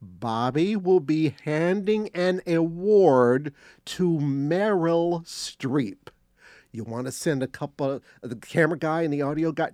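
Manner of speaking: slowed right down, each word spelled out; slow, deliberate retelling. Retold or imitated. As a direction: spell it out slowly, as if to a dim listener. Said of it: "let me say that again".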